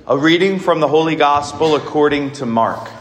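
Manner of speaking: measured liturgical reading voice; formal Gospel reading. Acted. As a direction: proclaim the Gospel solemnly.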